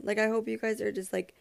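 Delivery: ordinary talk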